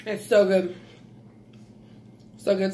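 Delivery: usual speaking voice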